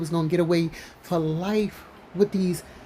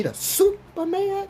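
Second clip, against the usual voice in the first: hyped super mayor; 13 semitones higher, slower than usual. Retold or imitated. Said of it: "So I gotta say it like that".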